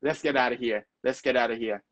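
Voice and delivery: let's get out of here blurred into lesketa-da-hia; connected movie pronunciation drill; linked-up speech. Shown as a demonstration. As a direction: run each phrase together fast, twice. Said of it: "แต่เวลาพูดจริงๆ เขาพูดแบบ".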